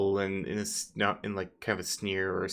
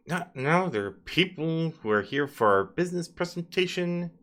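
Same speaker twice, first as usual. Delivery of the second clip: higher, scolding tone; irritated boss; 8 semitones higher than usual. Acted. NPC scolding troll about the presentation. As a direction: scold the servant. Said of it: "It's like".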